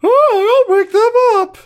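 put-on very high, loud girly voice; mock a girly radio voice. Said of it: "Why did his voice sound girly?".

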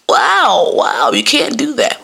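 high, drawn-out mock dismay; shocked teacher. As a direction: act scandalized by what was said. Teachers' shocked reaction, acted.

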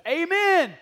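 called-out amen. Retold or imitated. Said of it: "he goes".

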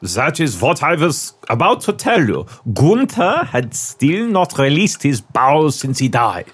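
shaky attempt at a German accent. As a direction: act it in a put-on German accent. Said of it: "my German accent's been very good".

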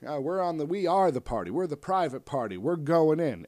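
high, loud, pushing to get in; indignant shouting. Pretend. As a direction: demand entry at full volume. Private Party forcing their way in.

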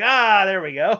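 a real laugh breaking through the words. Real, not acted. Laughing at his brother's Red Wings remark, loud and high.

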